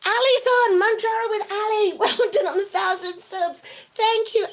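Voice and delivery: loud, high celebratory shout-out; excited cheer. Real, not acted. Streamer hailing a friend who joined, excited cheering. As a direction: whoop a friend's arrival and congratulate him. Greets friend joining, congratulates him.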